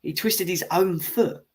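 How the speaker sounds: lightly mocking the chat's claim; mocking quote. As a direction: parrot the silly excuse with scorn. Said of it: "it's not a foul".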